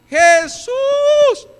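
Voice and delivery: very high, loud, sustained cry; desperate shout. Acted. Woman crying out to Jesus as he passes, shouting. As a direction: shout the name across a crowd. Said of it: "¿Se acuerdan también quién gritó?".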